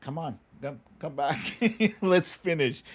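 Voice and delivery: lightly voiced playful cat begging its partner back; a hint of a pleading kitten; mock cat voice. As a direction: coax like a cat that wants to keep wrestling. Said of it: "you know, she's like".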